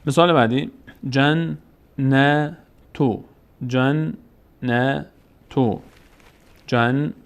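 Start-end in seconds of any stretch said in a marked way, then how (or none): 1.1-5.8 جنتو very slow, doubled nun and long aa; slow recitation. Shown as a demonstration. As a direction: stretch جنتو for learners. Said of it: "فتحه بعدش الف صدای آ".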